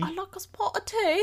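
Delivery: high-pitched mock British; attempted British accent. Shown as a demonstration. Echoing partner's British phrase, badly faked British accent. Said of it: "something you could say in British".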